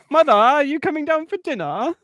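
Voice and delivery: high, loud call upstairs; sing-song calling voice. Pretend. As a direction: call up sweetly to mother. Voicing deluded son calling mother.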